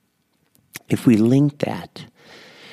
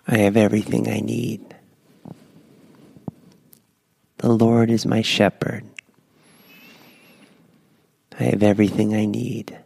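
guided breath prayer. Acted. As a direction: lead them gently through each breath.

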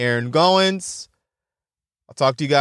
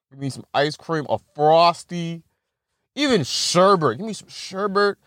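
ordinary talk, nothing marked

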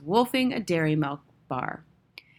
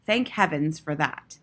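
ordinary delivery, nothing marked

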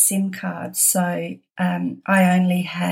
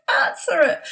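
excited urging; 11 semitones higher than usual. Retold or imitated.

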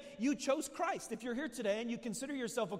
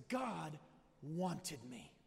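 quiet awe; 6 semitones lower, 6 dB quieter, much slower than usual. Real, not acted. Dropping low, awed.

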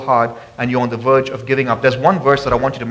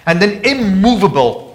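raised, ringing sermon voice; fervent scripture preaching; 4 semitones higher than usual. Acted. Preacher urging the church to be steadfast, ringing. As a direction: proclaim the verse to the congregation.

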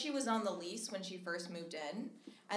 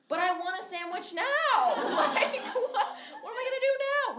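put-on high, louder, quick childish whine; high laughing sandwich whine; mock whine; 11 semitones higher, 8 dB louder, faster than usual. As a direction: whine like a hungry kid. Whining for her sandwich.

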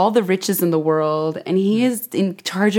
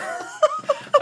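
laughing up high; 4 dB quieter than usual. Real, not acted. Guest laughing along with the host, high laugh. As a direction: giggle up high.

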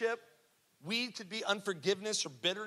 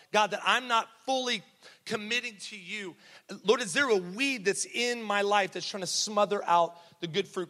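earnest prayer voice, a little higher; spoken prayer; 4 semitones higher, 3 dB louder than usual. Acted. Preacher modelling a daily prayer for the congregation, earnest. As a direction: plead with God as the congregation listens.

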